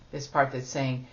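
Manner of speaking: usual speaking voice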